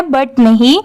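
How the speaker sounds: ordinary delivery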